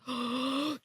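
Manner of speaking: sharp acted gasp of realization; sudden gasp. Harry spotting the twins, gasping at an idea. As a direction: gasp as if an idea just struck.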